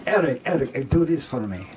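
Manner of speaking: put-on a touch of Russian accent, coaxing an actor; crazy Russian director. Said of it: "Andre's a crazy Russian".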